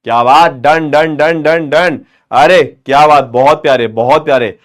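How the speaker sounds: loud, cheery praise for the class. Acted. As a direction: cheer the kids on, well done. Praising students.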